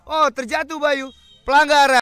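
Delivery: loud, high alarmed call; excited shout. Real, not acted. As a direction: cry out at the foul. Player falls, foul called.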